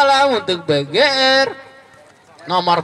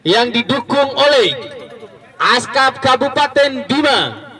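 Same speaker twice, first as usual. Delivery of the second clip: sponsor announcement; slower than usual. Acted. Reading out the sponsors.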